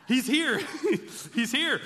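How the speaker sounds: excited. Retold or imitated. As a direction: squeal it out as the thrilled wife through laughter. Wife excitedly announcing the husband.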